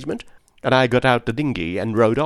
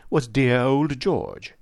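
usual speaking voice

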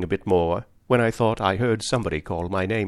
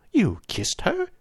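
incredulous; 11 semitones higher, slower than usual. Acted. Startled by the confession.

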